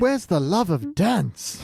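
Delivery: high, slow theatrical sigh; mock dramatic lament. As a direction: overact the tragedy.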